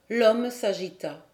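ordinary delivery

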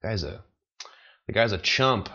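ordinary talk

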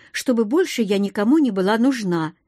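plain reading voice

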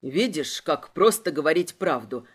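low, sneering interrogator; smirking, low-pitched menace; smug amusement. A narrator's reading. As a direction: gloat in a low voice. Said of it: "усмехнулся он".

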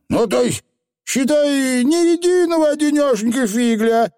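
squeaky little Scottish pixie; high, rough tiny rascal; Feegle voice. Acted.

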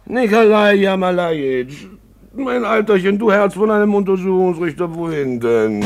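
put-on high, loud drunken call; calling after them. Drunk man calling after the departing magistrate, loud. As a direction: shout after the leaving guests.

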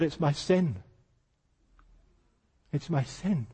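very soft, broken repeat; softly imitating a weeping woman; crying woman. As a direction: sob it out quietly, fading. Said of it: "crying her eyes out".

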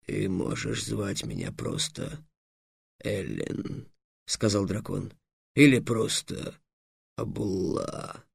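very low, soft, slow dragon voice. A narrator's reading. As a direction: offer the short names reluctantly. (низкий тихий голос дракона)

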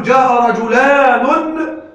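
"رجلان" drawn out slowly and loudly with its long -ān ending; slow teaching example. Shown as a demonstration. Teacher asks the class whether this is right, slow and emphatic. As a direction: slowly stretch "جاء رجلان" so the ending stands out. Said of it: "هل نقول".

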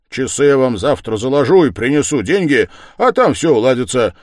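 loud, high, eager rush of plans; exuberant, full-voiced urging; excited shouting. A narrator's reading. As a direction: pitch it loud to sweep everyone along. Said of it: "закричал Разумихин".

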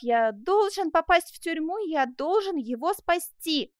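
slow, high, emphatic declaration; impassioned resolve. Acted. Man vows to rescue the jailed youth, impassioned. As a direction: stress each vow with fervour. Jacques vows to save his friend.

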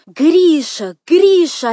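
high, loud frantic call; crying out. A narrator's reading. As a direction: scream his name for rescue. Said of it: "опять закричала Катя".

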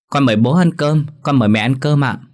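put-on a small child politely inviting parents to eat; politely inviting the parents to the meal; child's voice. A narrator's reading.

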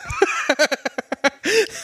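high-pitched burst of laughter. Real, not acted. Laugh after the quote.

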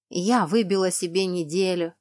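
ordinary delivery, nothing marked

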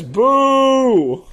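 loud, high boo; mock heckle. A heckler booing the stripper's Shakespeare, loud. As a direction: shout it down like a bored heckler.

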